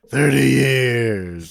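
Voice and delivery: slow, drawn-out mock movie line; imitated movie line. Quoting the fisherman, long and drawn-out. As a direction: stretch both words out long. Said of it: "he just goes".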